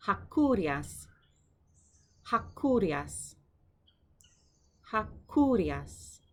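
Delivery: Hakurias said slowly, stress on ri; slow Greek model word. Shown as a demonstration. Teacher modelling word for students, slow careful pronunciation. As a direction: draw out Hakurias for learners.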